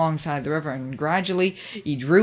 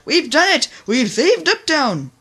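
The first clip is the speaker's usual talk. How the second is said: triumphant; 10 semitones higher, 7 dB louder than usual. Acted. Triumphant cheer after victory.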